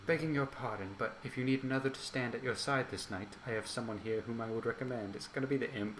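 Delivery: hushed, raspy character voice; soft and whispery, with a rasp. Acted. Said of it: "the more whispery, raspy one".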